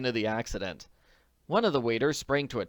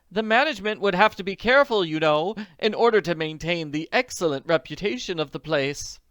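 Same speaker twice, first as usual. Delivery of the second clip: high, louder reassuring matron; higher comforting lady; 7 semitones higher, 7 dB louder than usual. Acted. Friend reassures Clara, high lady voice. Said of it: "said comfortingly".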